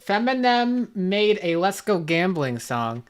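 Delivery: normal talking voice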